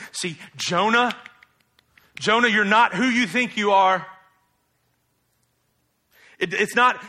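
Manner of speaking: usual speaking voice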